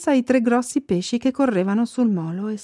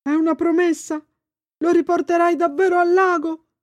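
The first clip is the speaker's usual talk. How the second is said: higher little fish voice, pressing; 8 semitones higher than usual. A narrator's reading. Fish pressing Thomas to promise he'll free Quick.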